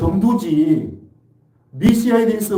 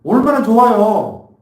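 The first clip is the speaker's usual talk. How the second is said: emphatic exclamation; 5 semitones higher, 7 dB louder, slower than usual. Real, not acted. Preacher exclaims to congregation.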